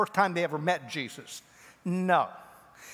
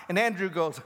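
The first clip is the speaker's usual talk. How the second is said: ordinary talk, nothing marked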